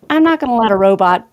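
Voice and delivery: ordinary talk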